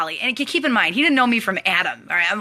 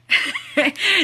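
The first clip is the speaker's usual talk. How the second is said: a bright real giggle, rising; high delighted laugh; 11 semitones higher than usual. Real, not acted. About the gift from Doc Ali, a high delighted laugh. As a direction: burst out laughing with joy.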